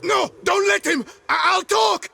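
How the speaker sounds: loud, high, terrified begging; frantic cry not to be hanged; panicked plea. Acted. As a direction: plead for your life in panic. Prisoner breaks under threat.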